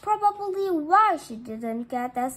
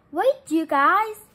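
loud call down the breezeway; 3 semitones higher, 8 dB louder than usual. Acted. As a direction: shout after them to stop. Boy calling after the girls.

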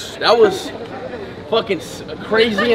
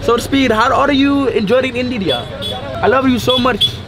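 higher-pitched put-on Indian accent; 5 semitones higher than usual. Guest showing off his perfected accent, Indian accent. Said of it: "I think I perfected the Indian accent".

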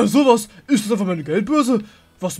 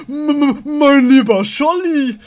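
amazed; 7 semitones higher, 10 dB louder, slower than usual. Acted. Amazed at the seaweed.

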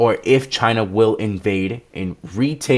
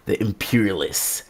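ordinary delivery

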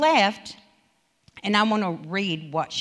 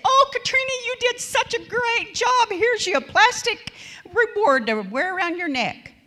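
mock gushing praise; 13 semitones higher, 4 dB louder than usual. Preacher mocking empty praise for Katrina, high and gushing. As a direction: gush over her like handing a cheap trophy. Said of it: "It'd be like me going and say".

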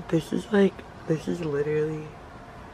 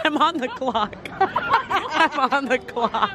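laughing quote; 7 semitones higher, 5 dB louder, slower than usual. Retold or imitated. Quoting him laughing.

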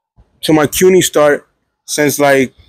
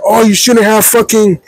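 higher-pitched, exasperated telling-off; scolding advisor; 6 semitones higher than usual. Retold or imitated. Advisor blaming student for the class choice, scolding.

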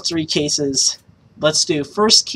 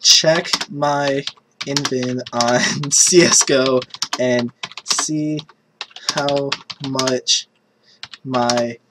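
slow, soft, word-by-word dictation; low, halting murmur while typing; typing aloud; 4 semitones lower, 8 dB quieter, slower than usual. Real, not acted. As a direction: say each word quietly as you type it, with gaps. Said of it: "I'm typing in Discord right now".

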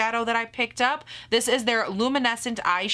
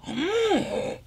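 high, drawn-out gasp of pleasure; delighted gasp; 12 semitones higher than usual. Real, not acted. Vlogger swatches the highlighter, gasping with delight. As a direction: gasp as if swept away by it.

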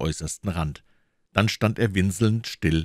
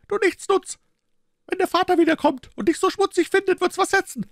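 high, raised voice of an angry mother; scolding shout; 5 dB louder, faster than usual. A narrator's reading. Mother threatens her dirty little boy, scolding shout. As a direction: threaten the naughty child in a high voice. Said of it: "schrie sie".

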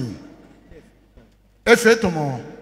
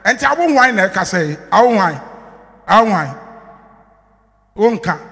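retold loud declaration; 3 semitones higher, 6 dB louder than usual. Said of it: "Krono say".

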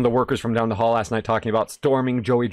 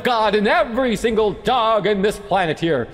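mock preacher; 10 semitones higher than usual. Gamer pretending to preach about dogs, hammed-up sermon. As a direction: preach it at full force, tongue in cheek.

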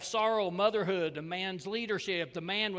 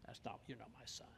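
cold rejection; 7 semitones lower, 20 dB quieter, much faster than usual. Retold or imitated. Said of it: "the father says".

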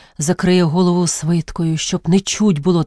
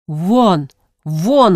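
shouting at a dog. Acted. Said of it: "гукнув він на собаку з надвору".

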